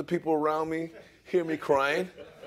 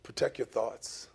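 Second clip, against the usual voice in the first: quiet; 7 semitones lower, 9 dB quieter than usual. Shown as a demonstration. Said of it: "repeated instruction, building to whisper".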